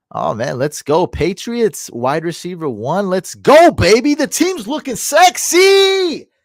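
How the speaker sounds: high, loud, excited cheering; hyped up. Real, not acted. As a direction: celebrate the draft at full volume. Hyped over a great draft.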